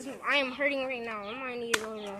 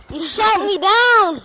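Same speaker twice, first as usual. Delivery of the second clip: loud complaint; 4 semitones higher, 10 dB louder, slower than usual. Real, not acted. Complaining after being shot.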